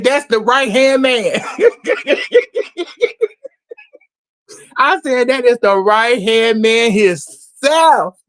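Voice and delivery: a slow, gleeful, laughing reveal; excited recognition. Real, not acted. Host recognizing the voice on the phone, loud and laughing.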